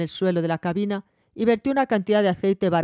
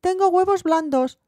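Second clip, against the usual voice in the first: put-on excited child; 12 semitones higher than usual. A narrator's reading. Child shows her find.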